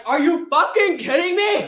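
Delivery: high, loud and slow, outraged; shocked German friend yelling at the diabetic; incredulous shout. Retold or imitated. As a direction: yell it in disbelief. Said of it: "And Sasha's like".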